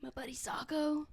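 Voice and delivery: soft fond whisper. Real, not acted. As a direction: whisper it fondly.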